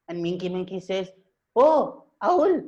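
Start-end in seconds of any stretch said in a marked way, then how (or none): 1.6-2.6 high-pitched surprised exclamation; startled monkey. Acted. Monkey woken at night by an owl, startled. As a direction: yelp it as you recognise who it is.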